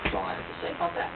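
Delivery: quick, low, soft throwaway; fast low deadpan. Real, not acted. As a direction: rattle it off quietly.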